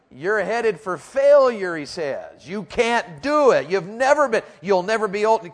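loud, high-pitched pulpit exhortation; full-force preaching. Real, not acted. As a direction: drive it home to the whole congregation.

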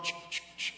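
soft, voiceless ticking like a blinker; turn signal clicks. Retold or imitated. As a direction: tick like a car's indicator.

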